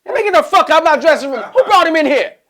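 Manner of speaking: loud high yell at the intruder; hammed-up star outburst; mock angry yell. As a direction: shout him out of the room. [name] yelling again.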